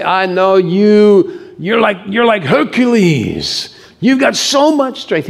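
high, drawn-out hammed-up awe; mock gushing praise. What Jesus doesn't say to the church, high mock gushing. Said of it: "He doesn't say".

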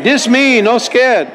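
high, sing-out Hawaiian Pidgin line. Acted. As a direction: call it out high in Hawaiian Pidgin for all to repeat. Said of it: "translation from our English into Hawaiian Pidgin".

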